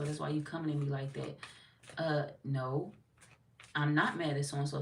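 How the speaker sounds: ordinary talk, nothing marked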